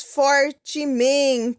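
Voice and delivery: "fortemente" very slow, syllable by syllable; emphatic stretching. Shown as a demonstration. Teacher instructs children what to paint, "fortemente" drawn out emphatically. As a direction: lean hard on "fortemente", stretching for-te-men-te.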